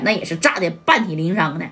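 usual speaking voice

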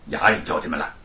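ordinary delivery, nothing marked

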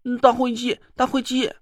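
put-on high childish doll calling out; a little doll crying out eagerly; doll shouting. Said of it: "泥娃娃大叫了起来". (泥娃娃兴奋大叫)

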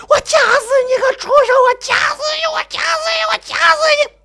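very high, frenzied screaming, repeated breathlessly; murderous shrieking. Acted. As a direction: scream as you throttle someone. Mother strangling narrator in a rage.

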